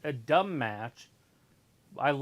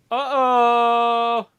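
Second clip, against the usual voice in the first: surprised; 9 semitones higher, 13 dB louder than usual. Real, not acted. Match drama.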